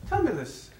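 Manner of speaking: high, eager pub chatter; excited Irishman. Retold or imitated. Irishman in a pub quizzing another drinker, high and eager. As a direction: lift the voice up high, keen to know. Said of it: "getting more and more excited".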